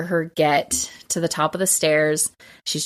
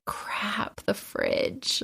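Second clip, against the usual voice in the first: a hushed, unvoiced realization; whispered dismay; 4 semitones higher, 9 dB quieter, much slower than usual. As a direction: breathe it out so he can't hear. Wife realizes a light remains.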